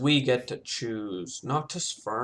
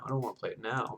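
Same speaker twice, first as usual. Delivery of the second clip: much softer echo of the thought; quiet repeat; 3 semitones lower, 8 dB quieter, faster than usual. Retold or imitated. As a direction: say it again under your breath. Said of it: "I was thinking".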